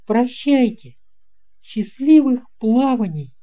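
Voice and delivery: put-on boy's voice pitched high, cheery goodbye; cheerful farewell. A narrator's reading. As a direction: wave him off with a lively boyish call. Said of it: "Алёшка бодро сказал".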